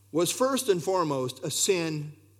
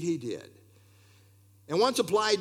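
usual speaking voice

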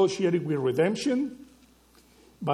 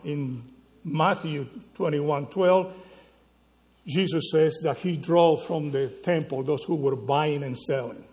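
normal talking voice

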